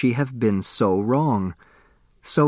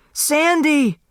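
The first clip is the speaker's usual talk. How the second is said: even higher, louder desperate scream; the second scream breaks higher still; screaming harder; 3 dB louder than usual. Acted. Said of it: "Turtle screamed".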